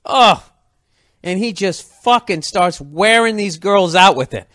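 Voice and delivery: ordinary talk, nothing marked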